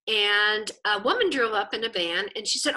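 ordinary talk, nothing marked

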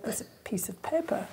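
whispered, baffled aside. Retold or imitated. Student puzzling over the coffee machine, whispered.

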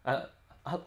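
ordinary talk, nothing marked

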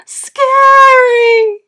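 very high, loud, drawn-out shriek. A narrator's reading. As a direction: shriek it in mock terror. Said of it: "they shrieked".

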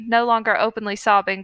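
usual speaking voice

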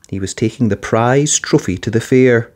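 plain reading voice

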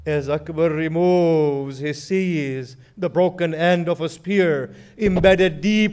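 rising, impassioned elegy for Karbala; mournful majlis recitation. Acted. Preacher recounting Akbar's death to mourners in lamenting chant. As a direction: chant the tragedy with swelling grief.

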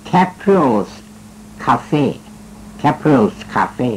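Caprio's Cafe read out slowly in English; English show title. Shown as a demonstration. Host reading the show's English name off a fax, slowly. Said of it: "เขียนกำกับมาอีกว่า รายการนี้ชื่อว่า".

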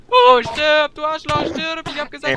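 yelling furiously at an enemy; angry shout. Real, not acted. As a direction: scream it at the monster. Fighting a mob in game.

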